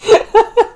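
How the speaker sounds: loud high burst of laughter; real laugh. Real, not acted. Reader losing it at the story, loud laugh. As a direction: crack up helplessly.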